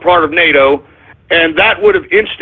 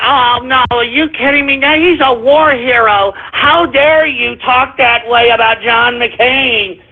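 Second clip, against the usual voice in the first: higher, whiny mock outrage of a McCain defender; higher, sneering imitation of a shocked admirer; mock outraged fan; 5 semitones higher than usual. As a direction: parody the scandalized believer. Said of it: "you're like".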